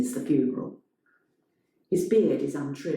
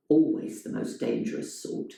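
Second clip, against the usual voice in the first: plain reading voice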